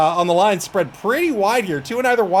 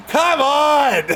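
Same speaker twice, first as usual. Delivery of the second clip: exasperated; 6 semitones higher, 3 dB louder than usual. Real, not acted. Louder higher exasperation.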